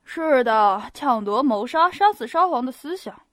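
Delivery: loud, mocking interjection; sarcastic. A narrator's reading. Mocking guest interrupting Pierre, sarcastic. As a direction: cut in with biting irony. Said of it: "一个含有讥讽的嗓音".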